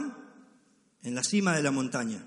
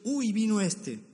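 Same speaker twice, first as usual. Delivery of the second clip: mock scorn; 7 semitones higher, 4 dB louder, slower than usual. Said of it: "voy a decir".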